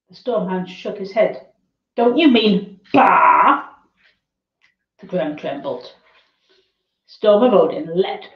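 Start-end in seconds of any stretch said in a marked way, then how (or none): 2.0-3.7 slow, loud exasperated correction. Acted. Hound corrects sheep.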